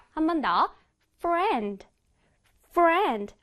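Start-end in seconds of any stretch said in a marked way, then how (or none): 1.2-3.3 crisp English F in "friend"; flicked F. Shown as a demonstration. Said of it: "아랫입술을 살짝 튕겨줘야 돼요".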